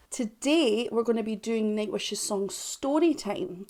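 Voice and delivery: ordinary talk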